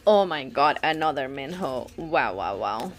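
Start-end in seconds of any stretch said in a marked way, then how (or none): 2.0-2.9 very low, deflated repeats; low deadpan disbelief; unimpressed wow. Real, not acted. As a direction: drop right down in pitch, unimpressed. Deadpan at another duplicate.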